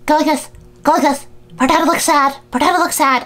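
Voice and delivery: high, insistent mock orders; mimicked bossy mother. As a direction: imitate a pushy mother directing a child. Mimicking mother ordering crying child.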